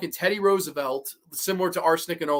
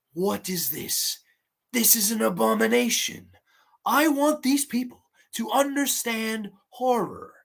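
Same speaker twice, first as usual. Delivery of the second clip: mock mafia boss; 5 semitones higher than usual. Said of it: "dressed like Vito Corleone".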